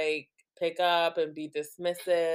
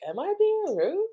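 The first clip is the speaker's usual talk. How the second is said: very high imitated kid voice, rising at the end; high-pitched mock-innocent child question. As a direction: squeak it up like a little girl asking. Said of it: "Like, she'll question".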